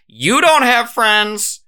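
high, slow cutting jab; deadpan wife jab. Retold or imitated. Wife in the corner cooking, jabbing her husband, high and slow. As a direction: skewer him from the kitchen, high and slow. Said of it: "she's like".

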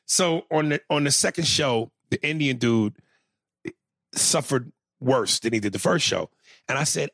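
ordinary delivery

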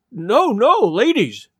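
high, agitated plea; frantic cry. A narrator's reading. Showman trying to stop the ladies, crying out. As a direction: protest as you hop about. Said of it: "cried Mister Thurkell, leaping about".

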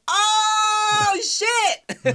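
long, high, loud drawn-out whoop; crowd's shocked cry. Retold or imitated. Acting out everyone's reaction to the reveal, shocked high yell. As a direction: erupt like a stunned audience.

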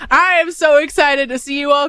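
high, bright and loud excitement; excited. Real, not acted. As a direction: gush over the milestone. Excited greeting, then repeated.

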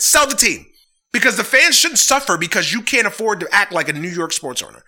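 usual speaking voice

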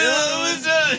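high, panting and pained; out of breath. Retold or imitated. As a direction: gasp it out, winded. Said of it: "now I'm out of breath and hurting".

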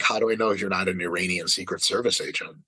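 normal talking voice

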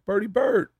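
high, cheery greeting; friendly greeting. Retold or imitated. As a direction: sing out his name brightly. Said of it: "was like".

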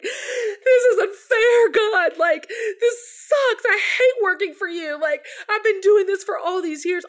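high, loud crying wail, slowed right down; sobbing complaint. Retold or imitated. Venting to God through tears, sobbing loudly. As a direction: wail it out through sobs like a baby. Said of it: "sobbing like a born baby".

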